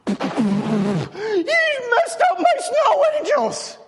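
fake-sob it like a crushed dad; mock wail. Dad reacts as son kicks angels.

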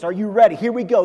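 ordinary delivery

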